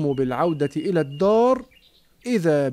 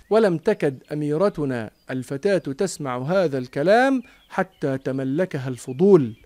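ordinary delivery

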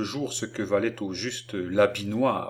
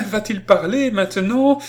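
high, loud excited question; overjoyed, overwrought; 11 semitones higher, 7 dB louder than usual. A narrator's reading. Little Fanchette asking if he will speak, overjoyed. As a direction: burst out with it, pitched right up. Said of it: "Fanchette riait et pleurait".

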